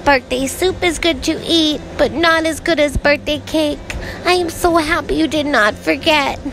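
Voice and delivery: a small cub thanking his mother, pitched high; Little Bear. Acted. Little Bear thanking Mother Bear, high happy cub voice.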